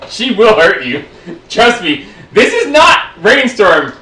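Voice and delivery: ordinary talk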